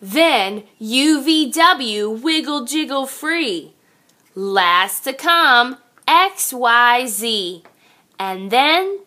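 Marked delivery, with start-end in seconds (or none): none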